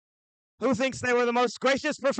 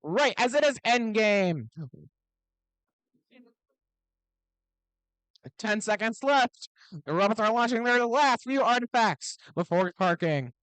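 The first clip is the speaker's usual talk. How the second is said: put-on broad singsong kids theatre. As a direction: pump up the crowd like an emcee. Event announcer hyping the crowd.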